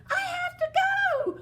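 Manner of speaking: high, loud panic; frantic escape; panicked cry. Acted. Cinderella panicking at midnight.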